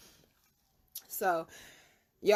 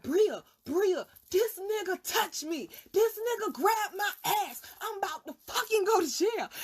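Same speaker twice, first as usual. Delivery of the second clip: much higher and louder, frantic yelling; panicked yell; 11 semitones higher, 4 dB louder than usual. Retold or imitated. Woman to her friend Bria, high frantic yelling. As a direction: burst in screaming for help.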